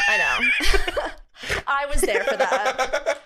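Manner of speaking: laughing loudly through the words. Real, not acted. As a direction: laugh it out, amused. Laughing at shared memory.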